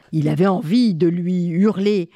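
ordinary delivery

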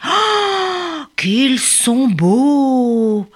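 slow, high, awed exclamation; wonder. Acted. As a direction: marvel at the rainbow birds. Crowd admiring costumes.

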